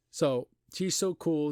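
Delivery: ordinary talk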